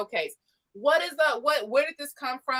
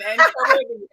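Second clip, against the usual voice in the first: laughing; 13 semitones higher than usual. Real, not acted. Host laughing at own failed attempt at the accent, laughing. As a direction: crack up while getting the words out.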